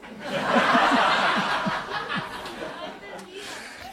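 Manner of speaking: risa larga y aguda; ríete fuerte; carcajada. Real, not acted. Risa tras el chiste. (carcajada larga y aguda)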